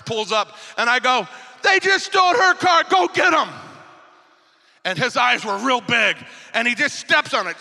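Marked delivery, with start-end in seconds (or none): 1.6-3.5 high, loud re-enacted yell; urgent shouted order. Man to his armor bearer as car is stolen, urgent re-enacted shout. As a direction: shout to send someone chasing.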